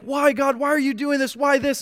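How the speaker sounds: high, pleading questions to God; pleading why. Retold or imitated. Believer questioning God in prayer, high and pleading. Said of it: "we're like".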